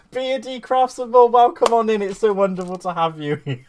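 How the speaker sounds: high, loud greeting just after laughing; excited welcome. Real, not acted. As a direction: hail a newcomer with gleeful volume. Welcoming a viewer through laughter.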